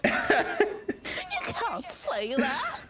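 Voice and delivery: very high, drawn-out laughing protest; shocked laughter. Real, not acted. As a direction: squeal a protest through laughter. Mock-shocked, laughing at friend's slur.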